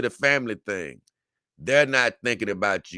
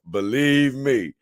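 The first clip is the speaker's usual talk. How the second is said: ordinary talk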